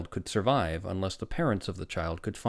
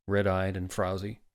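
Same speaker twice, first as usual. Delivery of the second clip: plain reading voice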